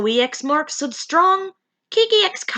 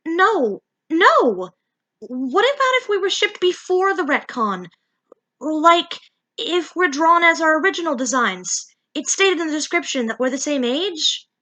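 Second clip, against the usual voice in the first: higher, urgent little voice; pleading Mimi; 6 semitones higher than usual. Acted. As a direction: plead with the narrator, pitched up. Mimi protests to narrator.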